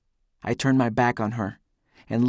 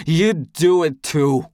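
loud, slow, higher angry accusation; hiss; 5 semitones higher, 7 dB louder, slower than usual. A narrator's reading. Punched Eldest accusing back, angrily hissing. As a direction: spit the accusation out slowly. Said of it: "he hisses".

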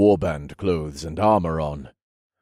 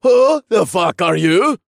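put-on loud, slow, high-pitched drunk; rowdy confrontational shout; drunken challenge; 13 semitones higher, 8 dB louder than usual. A narrator's reading. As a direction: demand it like a belligerent drunk. Drunk man challenges the narrator.

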